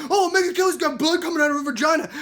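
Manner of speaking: high, loud mocking taunt; Donald Trump. Candidate insults a newswoman on the news, loud mocking jeer. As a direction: blurt it like a hammed-up heel.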